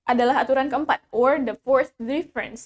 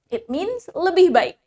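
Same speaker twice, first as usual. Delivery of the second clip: ordinary talk, nothing marked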